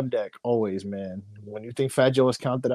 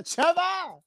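high called-out Jamaican Trevor; Jamaican accent. Pretend. Calling Jamaican name comedically.

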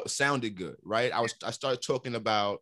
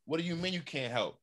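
higher-pitched, put-out pushback; retold indignant protest; 8 semitones higher than usual. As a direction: protest in disbelief, voice jumping up. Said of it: "I felt a certain kind of way".

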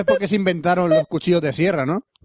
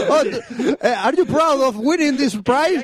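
mock interviewer; 8 semitones higher, slower than usual.

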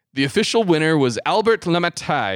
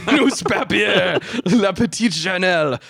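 put-on French accent; 4 semitones higher, 3 dB louder than usual. Host names the Paris paper for co-host, hammed-up French accent. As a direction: stretch "newspaper" out in hammy French. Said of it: "my accent too soon".

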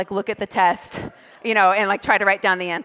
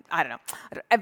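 ordinary talk, nothing marked

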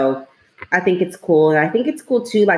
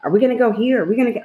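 imitating pestering son; 6 semitones higher, much faster than usual. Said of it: "he keeps asking me".

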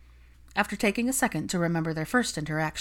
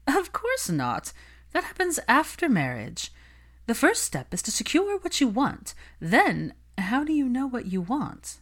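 higher, slow then brisk scoff; higher, patronizing Olivia explaining marriage; condescending socialite; 8 semitones higher than usual. Acted. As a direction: talk down to her like she's naive.

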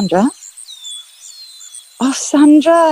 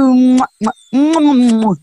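loud smacking kisses; 4 semitones lower, 8 dB louder than usual. Acted.

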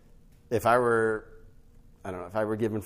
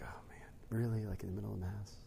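breathy hushed disbelief; whispered aside; 12 dB quieter than usual. Retold or imitated. Father whispering at his son's behaviour during Mass. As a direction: whisper it, shocked at a son in church. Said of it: "I was like".

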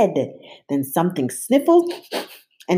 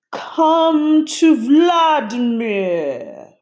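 very slow, louder, higher monster call; icy monster; 9 semitones higher, 6 dB louder, much slower than usual. A narrator's reading. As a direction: drag it out, icy.

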